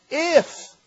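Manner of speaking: a lone "if" thrown way up high; stress on if. Shown as a demonstration. Preacher hammering the "if" of the verse. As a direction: isolate "if" with a big upward leap. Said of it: "put an emphasis on the if".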